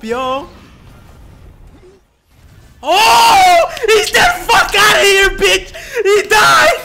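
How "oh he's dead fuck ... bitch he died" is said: high, loud laughing shouts at the screen; real hype yelling. Real, not acted. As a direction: yell it out, cracking up at the kills. Hype reaction, characters dying.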